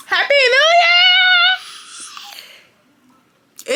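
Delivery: high, loud happy new year cheer; excited cheer. Real, not acted. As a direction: call it out at the top of your voice. New year arrives, shouting.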